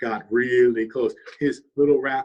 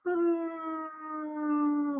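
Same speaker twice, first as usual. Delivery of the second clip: very high falling yelp of surprise; high startled wail of a raccoon going over a waterfall; falling cry; 5 dB quieter than usual. Acted. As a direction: cry out as he tumbles over the falls.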